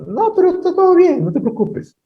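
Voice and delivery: high, put-on dismissive voice; mocking whiny excuse. Preacher mocking a proud believer brushing off God, in a high whiny voice. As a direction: brush off God in a squeaky mock voice.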